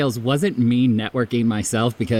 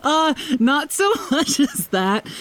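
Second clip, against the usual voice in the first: laughing denial; 13 semitones higher than usual. Real, not acted. Laughing denial after teasing.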